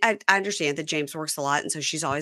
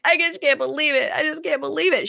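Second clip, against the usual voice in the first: upset disbelief; 8 semitones higher, 4 dB louder than usual. Retold or imitated. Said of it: "She's so mad".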